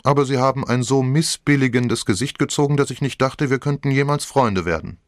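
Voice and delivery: hint of a saucy teenage girl; cheeky young woman. A narrator's reading. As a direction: tease him for an imaginary audience. Young woman voiced in story.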